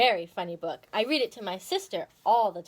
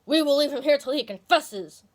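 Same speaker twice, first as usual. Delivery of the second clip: louder, stern bully squirrel; a louder, threatening Silvertail; 4 semitones higher, 6 dB louder than usual. A narrator's reading. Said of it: "said Silvertail Squirrel".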